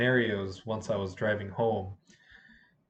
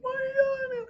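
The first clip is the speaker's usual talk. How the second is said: very high squealed question; mock protesting student. Girl answering the teacher mid-fight, high mock protest.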